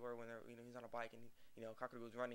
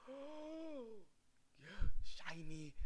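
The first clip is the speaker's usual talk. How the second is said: awed sister; 7 semitones higher, much slower than usual. Retold or imitated. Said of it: "his sister's like".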